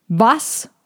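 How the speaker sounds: booming, disbelieving question; thundering voice. A narrator's reading. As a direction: thunder it as an outraged dragon elder. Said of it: "durch ihre Donnerstimme".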